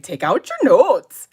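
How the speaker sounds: mock a thick Minnesotan accent, stretching the O in notes; exaggerated Minnesotan accent. Students teasing their teacher about how she talks, exaggerated Minnesotan accent. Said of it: "my students would be like".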